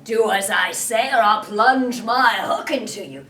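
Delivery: loud pirate threat; menacing Hook. Acted. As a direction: bark the threat.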